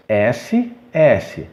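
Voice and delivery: ordinary talk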